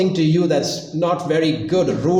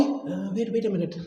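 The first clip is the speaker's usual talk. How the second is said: softer, reluctant mumbled delay; hesitant stalling; 8 dB quieter than usual. Acted. Someone asked to say sorry, stalling hesitantly. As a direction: stall softly, acting out reluctance.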